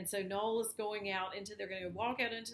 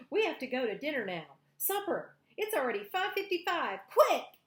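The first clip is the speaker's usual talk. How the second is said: higher, louder, fast urgent rush ending in a yell; a boy panicking about being late; hurried Valentine; 6 semitones higher, 9 dB louder than usual. Acted. Valentine hurries Noel home because dinner is at six.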